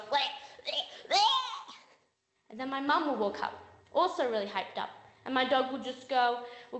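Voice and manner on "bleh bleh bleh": mock bleh noises, the last one leaping high; dog impression ending in a high yelp; imitated dog noises. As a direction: act out an unimpressed dog's bleh. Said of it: "my dog just stands there and goes".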